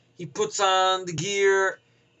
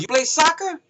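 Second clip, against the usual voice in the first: higher, louder mock kid question; kid's voice; 9 semitones higher, 4 dB louder than usual. Iraqi kid challenging Renner to soccer, high eager voice. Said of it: "He goes".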